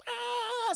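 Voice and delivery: high-pitched held mock screech; imitated sonic scream. Demonstrating her canary call, in a high mock sonic scream. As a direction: imitate a piercing canary cry, not too loud. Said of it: "Sonic scream".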